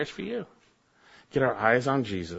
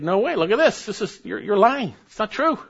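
higher and louder protest; indignant; 10 semitones higher, 4 dB louder than usual. Acted. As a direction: scoff at the lie. Preacher refutes heretics indignantly.

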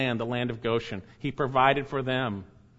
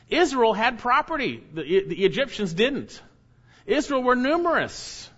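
emphatic contrast; 9 semitones higher than usual. Real, not acted. Preacher contrasts Israel with Egyptians.

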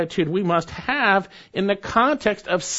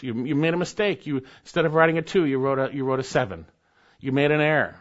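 ordinary talk, nothing marked

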